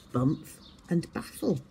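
usual speaking voice

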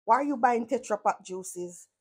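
usual speaking voice

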